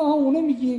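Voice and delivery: as if suddenly placing the name; surprised recognition. Retold or imitated. Teacher recognizes the student's father, surprised and high. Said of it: "گفت".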